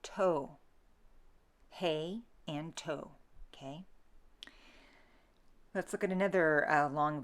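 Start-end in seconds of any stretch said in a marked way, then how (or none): 0.0-0.6 "toe" for to, with a long O; Middle English vowel. Shown as a demonstration. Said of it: "say toe". 1.7-3.1 "Hey and toe" for he and to, slowly; Middle English vowels. Shown as a demonstration. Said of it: "say hey".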